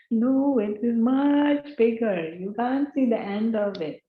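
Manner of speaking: convince him it's far bigger; insistent ocean frog. Acted.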